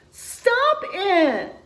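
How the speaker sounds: high, loud squeaky shout; squeaky little warthog. Acted. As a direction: squeak out to stop them. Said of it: "in a squeaky voice".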